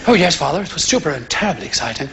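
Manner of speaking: low, quick, prim English son; Nigel Junior. Acted. Son answering father about the game, dutiful affected voice. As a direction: answer as the dutiful preacher's boy. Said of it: "my son, Nigel Junior".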